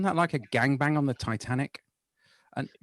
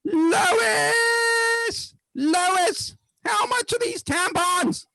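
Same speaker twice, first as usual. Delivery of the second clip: much higher, louder call across the shop, drawn out; shouting cashier; 4 dB louder, much slower than usual. Retold or imitated. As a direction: yell the name like a cashier broadcasting it to the queue. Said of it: "she goes".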